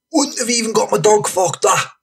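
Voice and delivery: high, theatrical rough-lad rant; Franny. Acted. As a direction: rant like a worked-up street storyteller. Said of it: "Franny says".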